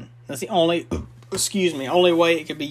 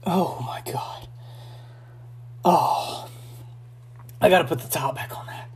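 eyes watering; 5 dB quieter than usual. Real, not acted. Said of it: "my eyes are watering".

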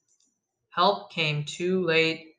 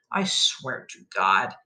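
low, slow muttered threat; exasperated oath; 7 semitones lower, 4 dB quieter than usual. A narrator's reading. Patient fed up with the noise, low slow oath. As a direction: grind it out slowly and low.

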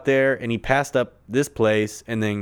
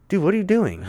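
exasperated retort; 9 semitones higher than usual. Retold or imitated. Retorting to cop.

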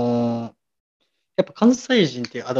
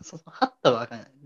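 ordinary talk

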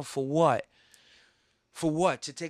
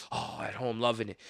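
ordinary talk, nothing marked

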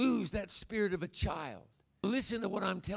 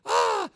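put-on high delighted squeal of a tossed child; child's squeal; 13 semitones higher, 7 dB louder than usual. Grandfather imitating grandson tossed in the air, high squeal.

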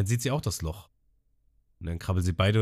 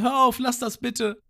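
flehende Abyss; 4 dB louder, slower than usual. Retold or imitated. Abyss bittet Ruffy aufzuhören.

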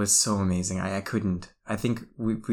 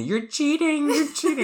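put-on whiny kid voice; 3 dB louder than usual. Kid accusing, imitated whiny.

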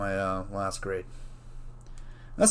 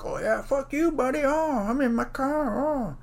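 much higher, slow taunting voice; mock smug driver. Imitating a rude driver flipping him off, taunting. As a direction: taunt like a smug driver safe in his car.

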